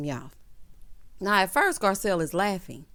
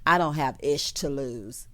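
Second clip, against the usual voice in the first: mocking Erica; 6 semitones lower, slower than usual. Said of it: "Talking about".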